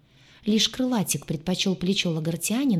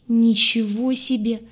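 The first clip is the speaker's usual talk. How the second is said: high, slow, drawn-out astonishment; almost moan it in disbelief, stretching it out; stunned, stretched exclamation; near-groaning amazement; 5 semitones higher, 4 dB louder, slower than usual. A narrator's reading. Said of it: "почти простонал Лаен".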